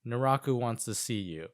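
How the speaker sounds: flat, emotionless monotone. Retold or imitated. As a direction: say it with no feeling at all. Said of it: "He's more emotionless".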